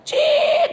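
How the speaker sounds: very high screeching brake noise; piercing drawn-out squeak; brake squeal. Retold or imitated. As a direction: imitate tyres squealing to a stop. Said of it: "direm apa".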